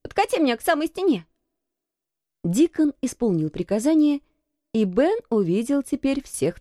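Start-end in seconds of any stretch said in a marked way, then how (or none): 0.0-1.3 put-on high, hurried boy's command; imperious boy, pitched high; called-out order. A narrator's reading. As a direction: order it quickly in a raised boy's voice. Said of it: "крикнул он".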